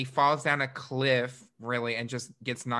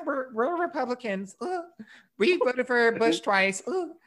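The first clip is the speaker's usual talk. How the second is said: hammed-up smug Republican, pitched up; mock Republican voice; 10 semitones higher than usual. Host mocking the family as smug Republicans, high sing-song.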